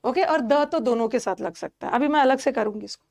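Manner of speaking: ordinary talk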